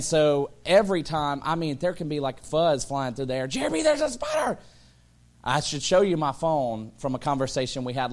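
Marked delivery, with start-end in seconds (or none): 3.5-4.6 high panicked cry of a scared wife; mock-frightened wife calling her husband; wife's panicked shriek. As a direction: squeal it like she's spotted a spider. Wife shrieking about spider.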